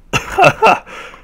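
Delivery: squealing laugh; a shrill burst of laughter; high laugh. Real, not acted. Laughs as zombie attacks.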